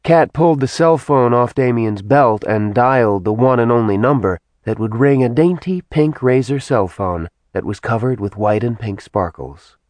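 plain reading voice

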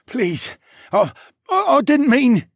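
high, loud stammering terror; stuttering plea. A narrator's reading. Terrified guard stutters excuses to the dragon, high. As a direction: stumble over the words in fear. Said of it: "the man stuttered".

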